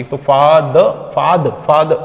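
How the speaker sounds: फाद cut dead after द, loud; chopped ending. Shown as a demonstration. Teacher shows what to avoid, chopped ending. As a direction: punch out फाद with no final vowel. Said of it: "ऐसे भी नहीं बोलना".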